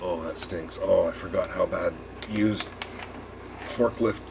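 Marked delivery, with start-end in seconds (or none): none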